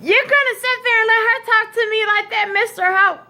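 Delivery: high, fast, indignant teacher whine; mocking teacher voice. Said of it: "So she".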